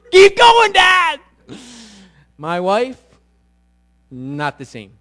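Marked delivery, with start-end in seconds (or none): 0.1-1.2 high, loud thrilled yell; a squealing, high kid's cheer; kids cheering. Retold or imitated. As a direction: shout it like a boy loving the ride. Boys cheering during donuts.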